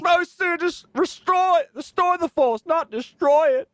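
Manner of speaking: high-pitched, hammed-up dramatic lament; anguished movie quote. Teasing the other musician over a fallen feature, mock-anguished. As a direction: wail it like a betrayed movie mentor, hammed-up.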